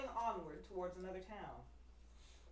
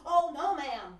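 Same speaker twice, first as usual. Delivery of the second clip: eager fib; 7 semitones higher, 13 dB louder, slower than usual. Acted. Pinocchio lying eagerly.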